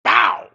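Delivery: loud imitated gunshot "bow"; gunshot bang. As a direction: punch out a gun blast.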